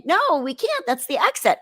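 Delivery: high-pitched objection, replayed; retold protest. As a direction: protest like a worried rule-follower.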